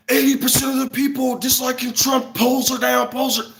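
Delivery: high-pitched mock newscaster; mock TV reporting. Imitating TV hyping poll results, high-pitched mockery.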